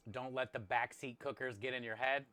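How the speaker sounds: usual speaking voice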